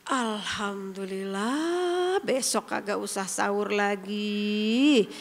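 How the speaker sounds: slow, low, lazy relieved drawl; weak believer's lazy mock relief; relieved thanks. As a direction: stretch it out, glad fasting is over. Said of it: "Beda intonasinya".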